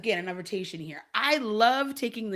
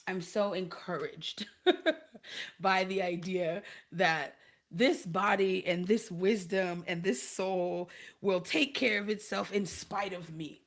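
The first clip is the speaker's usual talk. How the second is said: ordinary talk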